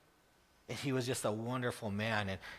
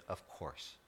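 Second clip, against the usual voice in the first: soft, low of course; low soft gentle reply; quiet reply; 5 semitones lower, 13 dB quieter than usual. Retold or imitated. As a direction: let Bob answer gently. Bob's quiet answer.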